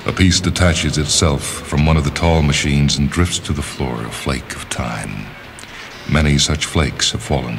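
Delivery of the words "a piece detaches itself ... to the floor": ordinary delivery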